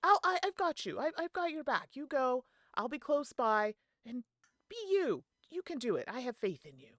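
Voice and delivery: rally her courage, bright and close; encouraging cheer. Acted. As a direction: Sal pumping up a nervous friend. Sal cheering Gertrude on.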